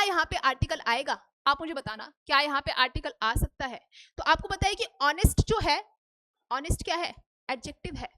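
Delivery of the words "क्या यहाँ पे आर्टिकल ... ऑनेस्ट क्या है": usual speaking voice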